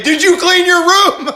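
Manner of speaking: high, loud, laughing shriek of a question; a hammed-up screeching parent; laughing yell. Shown as a demonstration. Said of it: "You can say".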